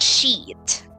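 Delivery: "sheet" with a drawn-out long E. Shown as a demonstration. Teacher shows students the word sheet, long E stretched. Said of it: "like, the long".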